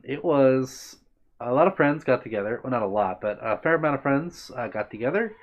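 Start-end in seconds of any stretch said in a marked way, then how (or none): none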